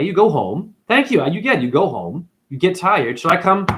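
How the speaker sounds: normal talking voice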